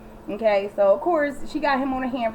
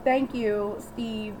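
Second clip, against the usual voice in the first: usual speaking voice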